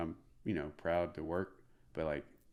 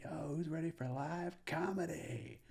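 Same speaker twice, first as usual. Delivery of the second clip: high, drawn-out hype call without full volume; retold stage scream; 11 semitones higher, 3 dB quieter than usual. Comic calls to the crowd from stage, mock scream. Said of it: "then I scream again".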